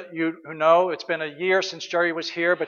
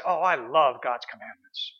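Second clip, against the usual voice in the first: mock adoring believer; 5 semitones lower than usual. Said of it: "heard somebody say".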